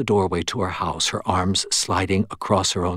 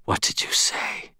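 stunned, voiceless whisper; 5 dB quieter than usual. A narrator's reading. Shaken Murphy questions the medium, faint whisper. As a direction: whisper it with no voice at all. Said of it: "she whispered".

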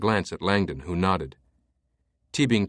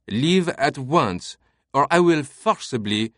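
higher, slow and rigid command; stiff barking toy soldier; 6 semitones higher, 4 dB louder than usual. A narrator's reading. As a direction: bark like a stiff little soldier. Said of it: "Remy now marched stiffly".

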